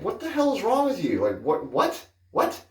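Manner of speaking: very high-pitched incredulity; acted disbelief. Shopper reacting to a weird stranger, high-pitched acted disbelief.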